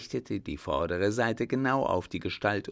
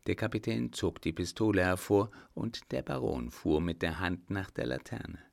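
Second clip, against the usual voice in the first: plain reading voice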